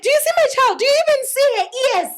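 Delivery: high, proud doting mother gushing; mom's proud voice. Retold or imitated. Mother boasting to guests about her daughter, high and proud. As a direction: gush over the child like a proud mom. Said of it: "my mom would be like".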